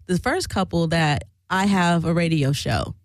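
usual speaking voice

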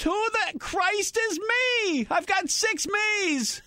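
high, slow mock-furious shout; angry mom yelling. Mom yells at her sneaking son, high slow mock fury. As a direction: drag it out like an exasperated mother of six.

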